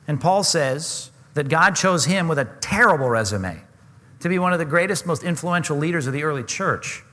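ordinary talk, nothing marked

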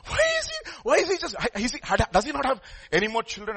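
put-on high-pitched, insistent child's questioning; pestering grandson. Grandson asks grandpa why he is watched, pestering child's voice. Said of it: "grandson goes to his grandfather".

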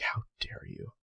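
hushed, voiceless mock indignation; mock outrage. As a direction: whisper it as if scandalized. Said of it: "gets angry".

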